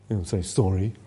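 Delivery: put-on a low, soft, mumbled kid's excuse; hint of a sheepish schoolboy; mildly imitating a sheepish child muttering; sulky pupil. Pupil's reply to angry teacher.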